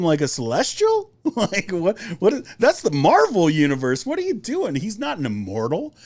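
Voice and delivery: high-pitched baffled protest; acting out a baffled fan's inner objection; incredulous. Inner part of him reacts, baffled.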